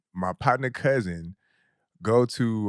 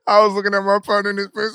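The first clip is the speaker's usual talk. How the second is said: laughing; 10 semitones higher, 6 dB louder, much faster than usual. Real, not acted. Laughing while retelling.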